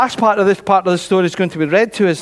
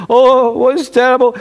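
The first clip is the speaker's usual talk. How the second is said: higher, louder fretting king, lightly acted; worried king; 5 semitones higher, 5 dB louder than usual. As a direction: act out a king's dismay. Pacing king fears for Daniel.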